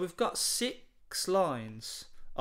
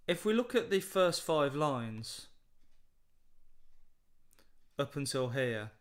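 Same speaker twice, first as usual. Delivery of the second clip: ordinary talk, nothing marked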